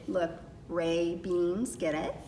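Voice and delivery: ordinary delivery, nothing marked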